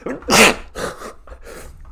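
real sneeze mid-sentence; sneezing. Real, not acted. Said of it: "before I sneezed".

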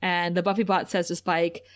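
usual speaking voice

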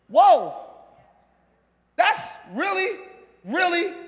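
ordinary talk

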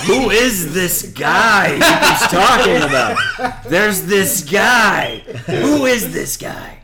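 high, slow, incredulous outcry; mock bafflement. Spies puzzling over the man everyone mentions, mock bafflement. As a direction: draw out each baffled question, half laughing.

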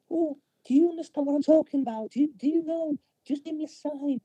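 high, fast, coaxing woman's voice; high gentle voice. Retold or imitated. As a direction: coax the alien in a high voice.

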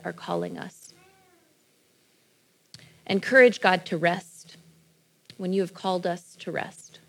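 low, soft, measured prayer. Real, not acted. Leads a prayer.

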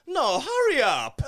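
slow, high, put-on impatient lady; mock impatient woman. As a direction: drag out an annoyed hurry up. Woman's retort in retold story.

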